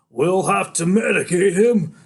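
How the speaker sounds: loud, high, slow call; cop calling out. A narrator's reading. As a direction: shout it over a struggle. Cops sitting on him call out.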